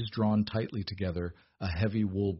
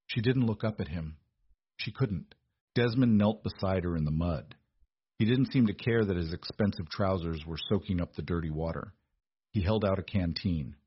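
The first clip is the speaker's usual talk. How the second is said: usual speaking voice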